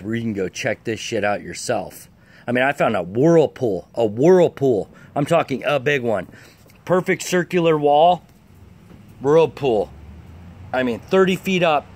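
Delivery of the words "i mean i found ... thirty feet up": excited. Real, not acted. Hyping the whirlpool discovery.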